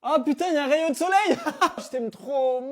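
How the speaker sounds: loud joyful laughing cry. Acted. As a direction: burst out laughing with relief. Sudden joy at sunshine.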